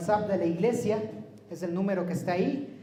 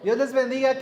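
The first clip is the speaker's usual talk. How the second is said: saludo en voz alta y más aguda; voz fuerte; 6 semitones higher, 8 dB louder than usual. Real, not acted. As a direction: proyecta el saludo para toda la sala. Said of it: "no tengo la voz muy fuerte". (saludar con voz fuerte)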